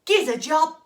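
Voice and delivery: loud, cheeky job plea; blurted demand. Acted. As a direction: call it out bluntly at the door. Jack calls out to farmer.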